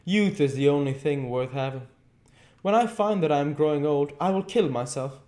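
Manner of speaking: hurt and jealous, voice rising; a hint of a flushed angry youth; almost angry. A narrator's reading. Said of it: "He seemed almost angry".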